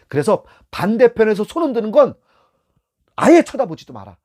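stern instructor warning; emphatic warning. Shown as a demonstration. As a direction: drive the rule home. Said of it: "emphatic rule to rookies".

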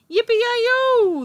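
high, loud yippee-yi-yo shout; a cowboy's joyful whoop on a galloping pig. A narrator's reading. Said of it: "the little man shouted".

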